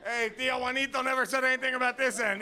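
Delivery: loud, high comic protest; hammed-up calling out; mock indignant shout. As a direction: holler it as if complaining mid-act. Acted shout in bit.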